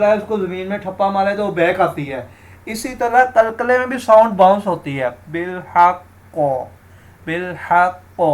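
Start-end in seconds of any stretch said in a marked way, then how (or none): 5.3-8.2 بالحق with the ق bouncing back; qalqala bounce. Shown as a demonstration. Teacher shows the bouncing qaf in بالحق. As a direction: let the ق of بالحق bounce like a ball. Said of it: "sound bounce آتی ہے".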